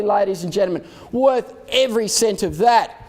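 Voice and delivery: usual speaking voice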